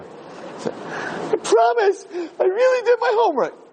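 put-on very high, whimpering child's voice, slow, on the edge of tears; crying little kid. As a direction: whimper the promise like a first grader about to cry. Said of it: "First grader".

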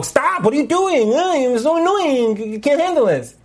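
high, whiny mock exasperation; annoyed rebuke. Shown as a demonstration. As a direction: snap as if fed up, drawing out the complaint. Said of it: "We don't say it like that".

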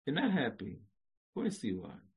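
usual speaking voice

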